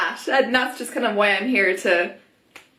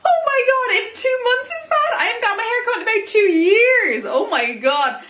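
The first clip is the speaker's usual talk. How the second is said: laughing disbelief; 11 semitones higher than usual. Retold or imitated. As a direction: crack up at the comparison. Laughing at own two years.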